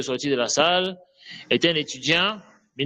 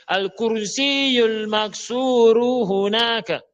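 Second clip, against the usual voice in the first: slow, drawn-out and higher, lightly voiced clumsy speaker; mock long repetition; 6 semitones higher, much slower than usual. Teacher mimics a student repeating the noun, slow and drawn out. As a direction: drag out the whole noun as someone who won't use the pronoun. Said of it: "Au lieu que la personne répète".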